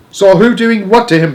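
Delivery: high, loud burst easing off at the end; exasperated shout. A narrator's reading. Father questioning grandmother about his son, exasperated shout. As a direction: burst out, then tail off exasperated. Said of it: "shouted Mister Jenkins".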